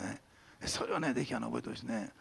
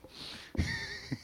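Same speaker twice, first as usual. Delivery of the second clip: high laugh; 8 dB quieter than usual. Real, not acted. Laughs at his own fickleness.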